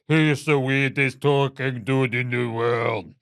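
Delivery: slow, hammed-up weird talker; wild voice impression. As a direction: drag it out in an oddball imitation. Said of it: "He's got the wildest voice".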